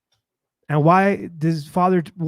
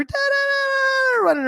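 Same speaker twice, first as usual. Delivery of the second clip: imitating little girl. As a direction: babble up high like a little girl. Imitates little girl running around.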